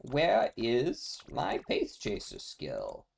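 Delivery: slow, stretched-out plaintive complaint; lightly whiny, slow lament over missing skills; drawn-out whine. Real, not acted. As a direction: drag each word out in mock despair. Whining about missing game skills.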